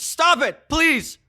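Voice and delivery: very high, loud desperate shouting; overwhelmed, pleading at full volume; freaking out. Acted. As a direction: scream for it to stop. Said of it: "freaks out".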